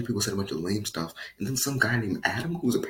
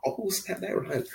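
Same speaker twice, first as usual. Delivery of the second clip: ordinary delivery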